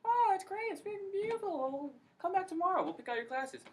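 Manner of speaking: mock falsetto, far above own voice; gushing advisors. Advisors welcoming a would-be pilot, mock gushing. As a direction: coo with fake delight, very high.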